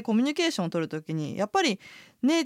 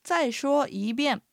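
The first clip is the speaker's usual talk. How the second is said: slow, higher, careful model phrase; review reading; 4 semitones higher, much slower than usual. Shown as a demonstration. Reviewing today's sentence for learners, slow and careful. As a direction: say it slowly and distinctly. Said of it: "もう一度おさらいしましょ".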